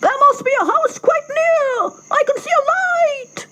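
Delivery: very high excited cock call; cock crying out. Acted. Cock calling to the animals, very high. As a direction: call out high to your friends. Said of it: "he cried out to his animal friends".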